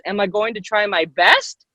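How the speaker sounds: "best" lifted sharply at the end; questioning rise. Shown as a demonstration. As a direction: start "Am I" low and swing up on "best". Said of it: "at the end of your sentence".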